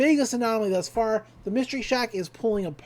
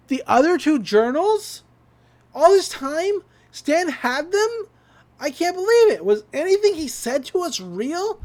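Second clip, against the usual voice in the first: shocked disbelief; 7 semitones higher, 4 dB louder than usual. Retold or imitated. Dipper shocked by Stan's secret.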